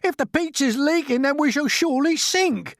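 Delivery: high, loud doom; panicky. A narrator's reading. Earthworm predicting they will sink, panicky. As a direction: certain we're doomed. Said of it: "cried the earthworm".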